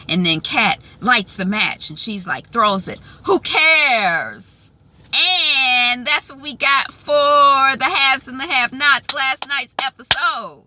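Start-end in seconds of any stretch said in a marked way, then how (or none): 3.2-4.6 loud, high dramatic dismissal; defiant shout. Retold or imitated. Acted defiant line tossing the match. 5.1-10.6 slow, loud, high drawn-out wrap-up; announcer sign-off. Real, not acted. Show recap sign-off.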